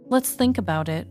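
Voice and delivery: usual speaking voice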